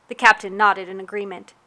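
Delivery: plain reading voice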